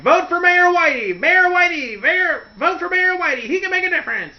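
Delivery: high, bright megaphone campaign call; megaphone announcer. Retold or imitated. Campaign car blaring through town, megaphone call. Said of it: "big megaphone car".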